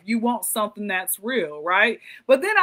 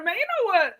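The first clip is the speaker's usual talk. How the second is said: normal talking voice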